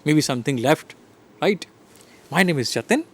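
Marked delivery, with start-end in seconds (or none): 2.3-3.0 "Jatin" lifted sharply as a question; unsure question ending high on "Jatin"; questioning rise. Shown as a demonstration. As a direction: ask it, voice climbing on "Jatin". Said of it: "am I asking question to myself".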